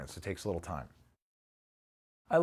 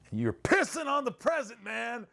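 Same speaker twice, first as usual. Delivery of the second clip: mock therapist yell. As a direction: ham up the therapist scolding, pitched way up. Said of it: "he didn't yell at me, though".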